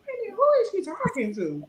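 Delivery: high, quick puzzled aside; baffled. Retold or imitated. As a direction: wonder aloud who she means. Baffled at girl's remark.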